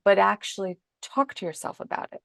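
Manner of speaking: ordinary delivery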